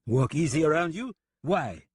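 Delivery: put-on taunting French-accented echo, pitched high; strange French accent. French gunman echoes the deputy's warning, taunting French accent. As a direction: throw it back at him in a French accent. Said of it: "in his strange accent".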